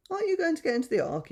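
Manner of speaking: high, quick little soldier voice; soldier asking. Acted. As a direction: pipe up fast and high as a toy soldier. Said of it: "he asked".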